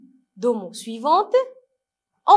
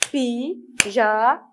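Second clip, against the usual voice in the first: "Pyjama" slowly split as py, ja, ma; "Pyjama" in three separate, stretched syllables; much slower than usual. Shown as a demonstration. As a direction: count out "Pyjama" with a gap after each syllable. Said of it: "Combien de syllabes?".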